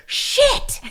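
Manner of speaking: high, loud pained expletive yell; top-of-lungs yell. Retold or imitated. Mom slices her finger cutting fruit, top-of-lungs yell. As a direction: reenact a sudden full-voiced scream. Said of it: "yell at the top of my lungs".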